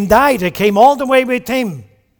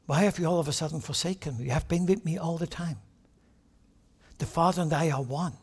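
hushed doubting plea; 5 semitones lower, 11 dB quieter, faster than usual. Retold or imitated. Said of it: "Jesus would have doubted".

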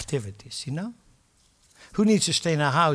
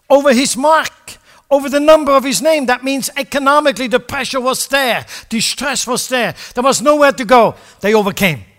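louder, higher, forceful exhortation; preaching at full force; 8 semitones higher, 10 dB louder than usual. Acted. Preacher urging the congregation to persevere, at full force. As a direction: drive it home like a fired-up preacher.

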